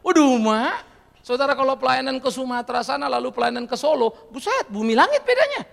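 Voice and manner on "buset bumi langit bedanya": higher and louder, astonished; astonished exclaim. Real, not acted. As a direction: marvel at the huge difference. Preacher exclaims at difference. (buset diserukan tinggi dan keras, terheran-heran)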